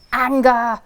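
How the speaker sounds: shouted. Shown as a demonstration. Naming the feeling to the children again, shouted loud. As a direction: bark the word Anger! out loud.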